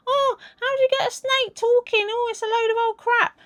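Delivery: high, scoffing mock voice; mocking scoffers. People scoffing at a talking snake, in a high mocking voice. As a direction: mimic the doubters with a high sneer. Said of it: "'cause people go".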